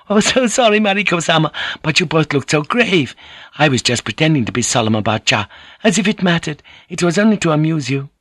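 high, lively hostess voice; playful hostess, apologetic. A narrator's reading. Kiku apologizes after laughing.

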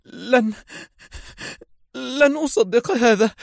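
grieving woman rejecting the news; forceful outcry. A narrator's reading. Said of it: "في قوة صارخة".

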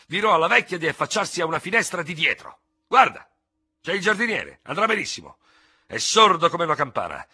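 high, loud, impetuous tirade; heated impatience. A narrator's reading. Ferraud insists on duelling at once without seconds, impatiently shouting. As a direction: sweep every objection aside, nearly shouting. Hothead brushes aside objections to duel.

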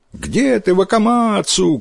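high-pitched, drawn call of schoolboys. A narrator's reading. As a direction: call for a missing friend from afar. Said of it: "кричат они".

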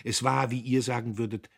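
usual speaking voice

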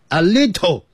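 high Chinese-accented "lee-toe" for "little". Shown as a demonstration. Spy given away by his English, Chinese-accented "little". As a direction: imitate a Chinese learner's English. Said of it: "你是老中".

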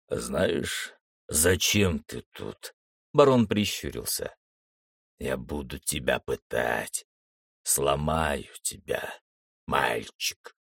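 low, slow sinister threat; a cruel baron savouring it; menacing villain. Acted.

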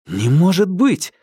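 loud, high exclamation of disbelief; exclaiming. A narrator's reading. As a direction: burst out in shocked disbelief. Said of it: "воскликнул Марк".

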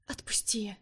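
breathy unvoiced plea, very soft; whispered. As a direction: whisper it, barely letting go of air. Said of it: "прошептала она".

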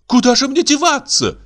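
very high cry of dismay; acted aghast wife; dismayed gasp. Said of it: "ахнула Каролина".

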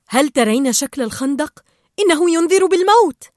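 rising to a loud, high warning; alarmed warning. Acted. Marlin warning Dory about the trench, increasingly alarmed. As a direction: build in pitch and volume to the last word.

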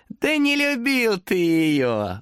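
high, slow smirking demon voice. A narrator's reading. Demon smirks, denying Thomas's love. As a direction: stretch it out with a sneer. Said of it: "ухмыльнулся демон".

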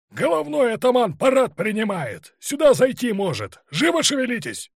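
high, loud hectoring yell; urgent shouted orders. A narrator's reading. Officer rousing lazy Cossacks before the ataman comes, shouting. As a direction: drive them on at full voice.

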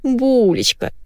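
high, cooing pet talk; baby talk. A narrator's reading. Wife cooing to her dog by phone, baby talk. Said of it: "засюсюкала Гарпия".

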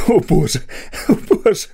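Garraty gasping, laughing helplessly; breathless laughing gasp. Acted. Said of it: "видихнув він".

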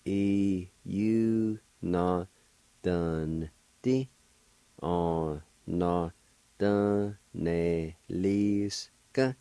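syllables drawn out in one slow sequence; measured, sustained teaching pronunciation; slow syllable demo. Shown as a demonstration. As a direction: sound it out very slowly, syllable by syllable. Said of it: "And together".

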